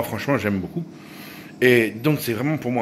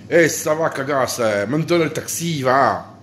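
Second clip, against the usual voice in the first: child's reply in hammed-up southern French accent, raised pitch; kid imitating his French accent; 4 semitones higher, slower than usual. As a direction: answer cheekily in an exaggerated regional French accent. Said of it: "parler avec mon accent". (imitation d'enfant avec accent français du Midi exagéré)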